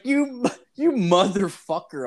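high, slow, stunned drawl; hammed-up shock at his own father; mock outraged. Son retorting to his dad in mock outrage.

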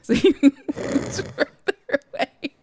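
high, loud, slowed by laughter; real laughing. Real, not acted. Storyteller on the man moving away, cracking up. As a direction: barely get it out for laughing.

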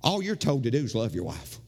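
normal talking voice

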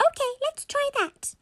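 very high-pitched squeaky mum; squeaky toy voice. Acted. Mummy agrees to try the toy, squeaky voice. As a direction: say it in a tiny squeak. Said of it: "albeit in a very squeaky tone".

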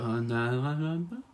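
numb-headed babbling, rising at the end; starstruck. Acted. Fan handing over pen and paper, starstruck. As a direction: babble helplessly, words failing you. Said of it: "gone a bit numb in the head".

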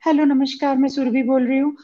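put-on high, sweet girl's phone voice; man doing a woman's voice. Shown as a demonstration. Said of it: "आपको लड़की की आवाज निकालनी है".

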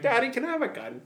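put-on high, soft little-kid voice; bambino di sei anni. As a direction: play a six-year-old asking sweetly, high and small. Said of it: "My six-year-old's gonna watch".

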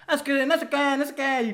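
put-on high, singsong Mexico City accent; mocking chilango impression. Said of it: "escuchaba a todos hablando así igualito".

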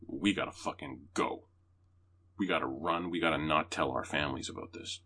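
whisper-urgent plan to flee; urgent low plea. Retold or imitated. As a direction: lawyer urging her partner in a low tense voice. Panicked flight planning.

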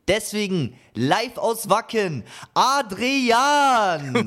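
loud, high, stretched-out name call; show announcer intro. Pretend. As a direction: announce your co-host like a ring entrance. Moderator kündigt den Co-Host an.

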